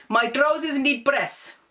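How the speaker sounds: put-on slow, high, lightly accented parent; Desi accent. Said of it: "They will say".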